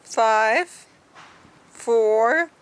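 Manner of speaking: counting down with a shout; loud countdown. Real, not acted. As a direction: call out the countdown loudly. Counting down aloud.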